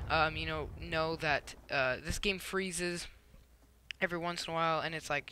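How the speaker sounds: usual speaking voice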